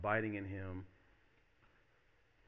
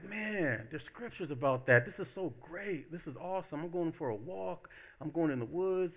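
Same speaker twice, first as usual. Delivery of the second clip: higher, gushing enthusiasm; enthusiastic nature lover; 8 semitones higher than usual. Acted. Nature lover telling puzzled friends about the woods, enthusiastic. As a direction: marvel in a raised voice.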